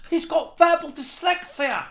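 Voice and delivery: high, slow put-on jeer again; mocking heckler. Heckler repeating his jibe, slow high jeer. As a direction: stretch it out, mocking. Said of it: "This fellow stood up and he went".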